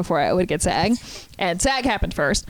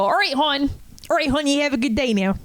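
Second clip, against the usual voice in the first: put-on lightly done Maryland accent, all right hon; a hint of a tired Maryland cashier; Maryland grocery clerk accent; 4 semitones higher, faster than usual. Maryland clerk wishing a customer good day, light Maryland accent. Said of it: "So a good one".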